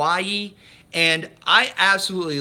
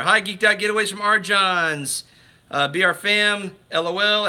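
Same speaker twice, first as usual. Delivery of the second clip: ordinary delivery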